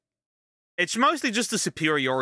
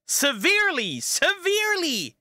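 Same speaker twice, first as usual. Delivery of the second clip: "severely" each time higher, climbing sharply; escalating emphasis; 12 semitones higher than usual. Real, not acted. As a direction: push "severely" higher with every repeat.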